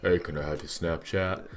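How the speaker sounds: mocking, dopey low-pitched voice; goofy mock pickup line in a put-on voice; ugly girl caricature. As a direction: put on an unflattering girl's voice. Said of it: "that ugly girl who's like".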